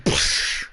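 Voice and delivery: loud voiceless "pshh" explosion; imitated gunshot blast. Storyteller showing the cop being shot, mouthed blast. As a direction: burst out a gunshot noise with the mouth.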